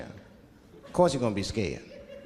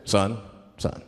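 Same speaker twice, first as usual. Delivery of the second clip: low, stern mock cop voice; police officer; 10 semitones lower than usual. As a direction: address the pulled-over driver with deep authority. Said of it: "the police pull me over".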